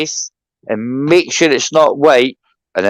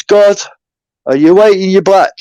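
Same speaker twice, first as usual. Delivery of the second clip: calling out; 5 semitones higher, 4 dB louder than usual. Retold or imitated. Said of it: "he says".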